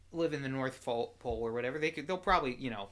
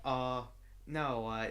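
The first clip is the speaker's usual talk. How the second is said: usual speaking voice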